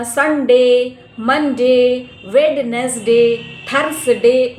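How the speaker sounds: Wednesday and Thursday said letter by letter, loud; wrong day names. Shown as a demonstration. Teacher mocking wrong days, loud and spelled out. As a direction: spell out वेडनेसडे with every letter sounded. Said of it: "no, not like that".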